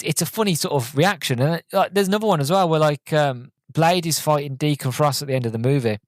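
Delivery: ordinary talk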